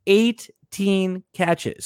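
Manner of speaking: Eighteen said very slowly and high, each syllable stretched; emphatic repetition. Real, not acted. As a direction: lean hard on Eighteen, drawn right out. Repeating the stat for emphasis.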